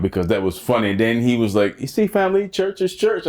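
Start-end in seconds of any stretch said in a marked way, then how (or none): 1.8-3.3 high-pitched mock sketch voice; playful imitation in a raised voice; imitated sketch character. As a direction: act out the sketch comic, pitched way up. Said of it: "he broke into this".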